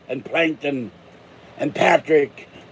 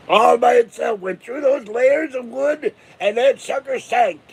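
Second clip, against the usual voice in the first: hammed-up pirate tale; 7 semitones higher than usual. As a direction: boom it out like a tavern storyteller. Tall tale of Oak Island.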